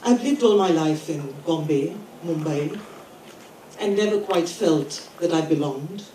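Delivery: normal talking voice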